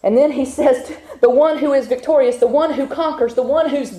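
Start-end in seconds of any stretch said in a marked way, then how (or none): none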